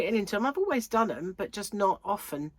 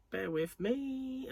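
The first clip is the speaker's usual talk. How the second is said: slow drawn aside; 6 semitones higher, 6 dB quieter, much slower than usual. Real, not acted. Reading a message.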